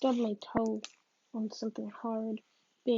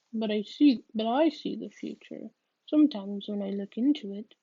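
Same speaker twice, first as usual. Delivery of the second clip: louder, weighty declaration; mysterious claim; 7 dB louder than usual. Acted. Psychic revealing her gift to visitor, loud and weighty. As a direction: proclaim it with gravity. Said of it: "After a pause, she continues".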